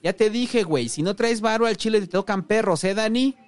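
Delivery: high, loud angry yell; shouted warning. A narrator's reading. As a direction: holler a threat through the door. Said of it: "gritó".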